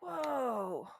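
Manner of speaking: soft, drawn-out falling whoa; mock amazement, softly let out; acted awed gasp. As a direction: play a hushed crowd gasping in awe. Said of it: "some people gasp and go".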